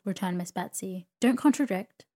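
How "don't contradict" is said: louder, higher scolding; sharp rebuke. A narrator's reading. Aunt forbidding the young mother to contradict, sharp. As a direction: shut the objection down firmly.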